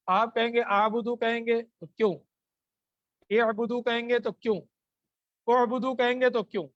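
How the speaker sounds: normal talking voice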